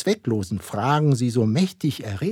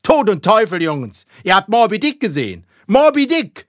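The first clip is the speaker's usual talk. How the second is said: loud, high exclamation climbing higher; frenzied cry; 11 semitones higher, 8 dB louder than usual. A narrator's reading. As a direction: build to screaming out the name. Ahab's climactic cry of the name.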